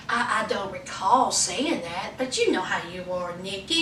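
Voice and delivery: low, soft, condescending. Retold or imitated. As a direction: quietly needle her with passive-aggressive calm. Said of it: "he was condescending, a little passive aggressive".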